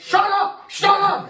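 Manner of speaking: shrieking high, loud shut up; mock furious shout. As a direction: scream it in mock rage at the genie. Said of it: "and I'm like".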